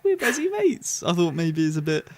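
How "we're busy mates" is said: swooning Belinda. Retold or imitated. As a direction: gush it up high like a smitten fan. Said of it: "she's like".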